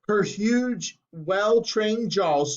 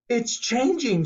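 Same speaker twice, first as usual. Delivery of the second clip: excited shout; 7 semitones higher, slower than usual. A narrator's reading. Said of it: "shouted Violet".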